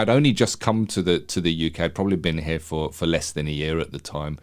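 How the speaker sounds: ordinary talk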